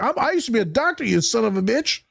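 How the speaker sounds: angry janitor. Retold or imitated. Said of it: "He's like".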